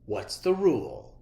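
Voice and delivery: a hint of a strict teacher; stern teacher. A narrator's reading. Teacher to Clark in class, firm. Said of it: "said Miss Inkydink".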